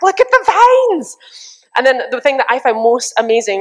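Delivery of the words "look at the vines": much higher, slow thrilled cry; excited shout. Retold or imitated. Friends driving through jungle, thrilled shout. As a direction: point and shout at the vines. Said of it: "we'd be like".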